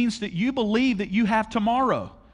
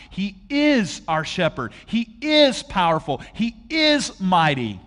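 each "is" punched, slowly; emphatic preaching; 3 dB louder, slower than usual. Acted. Preacher to congregation, slow stress on is. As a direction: hammer the word is, line by line.

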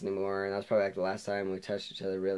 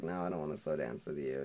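mumbled nervous refusal; 6 semitones lower, 6 dB quieter than usual. Retold or imitated. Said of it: "I was kinda scared".

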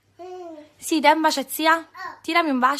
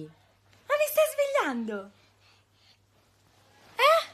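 mock surprise; 13 semitones higher, 4 dB quieter, slower than usual. Pretend waking up.

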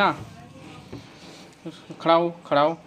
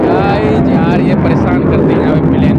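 loud, higher, excited over plane noise; shouting over plane; 4 semitones higher, 12 dB louder than usual. Real, not acted. (हवाई जहाज़ के शोर में ज़ोर से)

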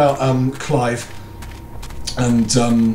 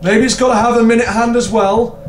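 repeated much higher and louder; high questioning; 8 semitones higher, 4 dB louder than usual. Real, not acted. Puzzled player repeating the question in a high squeak. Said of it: "repeated higher".

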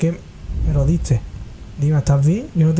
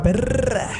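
mock scolding; 7 semitones higher than usual. Mock insult at crying character.

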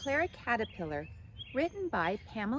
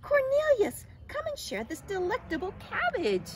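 high, loud happy summons; thrilled caterpillar hollering; excited calling; 13 semitones higher, 6 dB louder than usual. A narrator's reading. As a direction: call a friend over eagerly in high pitch. Clara excitedly calls her friend.

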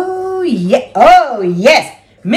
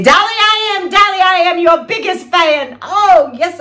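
gushing fan; 8 semitones higher than usual. Pretend. Imagined meeting with [name].

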